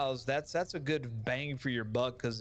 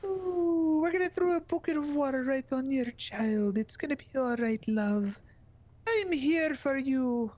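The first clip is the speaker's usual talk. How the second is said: Mrs. Doubtfire impression; 12 semitones higher, 4 dB louder than usual. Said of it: "That is a good Missus Doubtfire".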